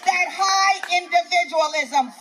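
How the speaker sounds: normal talking voice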